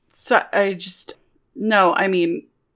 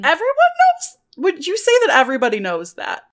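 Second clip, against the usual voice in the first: high, alarmed questioning; flustered disbelief; 10 semitones higher than usual. Acted. Lou learns the crush shows, flustered and high. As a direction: squeak it, then question quickly.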